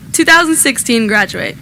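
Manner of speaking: ordinary talk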